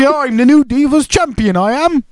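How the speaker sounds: high, hammed-up fake British accent; mock a bad British accent, pitched high; awful British accent. Imitating a wrestler's terrible accent, high mock British. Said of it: "How bad an accent are we talking?".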